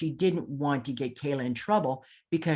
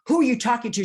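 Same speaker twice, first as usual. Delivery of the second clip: sharp, much higher, louder retold challenge; angry demand; 9 semitones higher, 6 dB louder, much faster than usual. The deputy sheriff challenging Robin in the courthouse, loud and high. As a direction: bark it at her like a suspicious deputy. Deputy confronting sister on phone.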